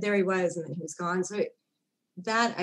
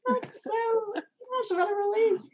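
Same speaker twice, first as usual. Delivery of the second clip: tearful; slower than usual. Real, not acted. Relief after finding her heritage.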